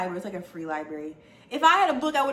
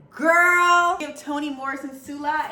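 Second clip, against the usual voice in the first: excited shout; 7 semitones higher, 9 dB louder than usual. Real, not acted. Excited exclamation about new book.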